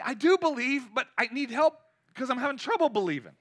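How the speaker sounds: acted plea of a struggling believer; pleading. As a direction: confess it urgently, asking for help.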